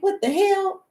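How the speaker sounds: high, startled outburst; sudden high-pitched shock; shocked what the hell. Pretend. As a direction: jump up high in disbelief. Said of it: "you're gonna be like".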